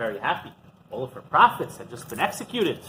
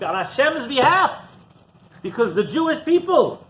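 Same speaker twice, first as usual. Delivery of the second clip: higher, pleading indignant prophet's voice; a prophet protesting his lonely zeal, pitched up; zealous prophet; 11 semitones higher, 4 dB louder than usual. Acted. Prophet Eliyahu complaining to God, higher and indignant. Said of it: "He says".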